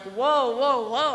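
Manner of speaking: a half-acted taken-aback protest; startled whoa. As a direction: back off in surprise, mildly reenacted. Alarmed reply to angry friend.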